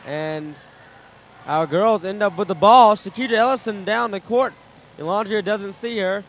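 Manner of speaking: calling the fast break, voice climbing; excited play-by-play. Real, not acted.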